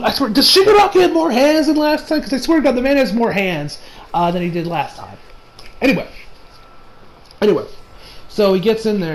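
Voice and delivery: ordinary delivery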